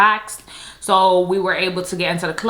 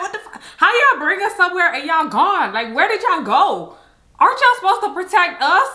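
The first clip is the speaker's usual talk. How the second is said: higher, exasperated demand; indignant outburst; 9 semitones higher than usual. Retold or imitated. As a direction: call them out for abandoning you. Angry at vanished escorts.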